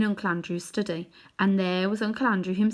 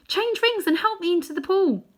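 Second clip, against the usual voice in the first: higher, louder hurried plea; urgent plea; 11 semitones higher, 5 dB louder than usual. A narrator's reading. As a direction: urge him, no time to lose. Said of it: "said Polly".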